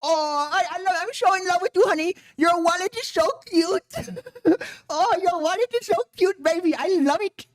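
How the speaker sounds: high-pitched, gushing Indian wife parody; mock adoring wife. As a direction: coo over your honey in a put-on accent. Mocking wife gushing over husband's wallet.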